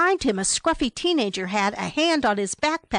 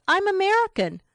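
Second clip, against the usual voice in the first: much higher, taken-aback protest; stunned; 11 semitones higher, faster than usual. A narrator's reading. Stunned traveler answers the hostel clerk, taken aback. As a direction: say it high, in disbelief. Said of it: "Brent was stunned".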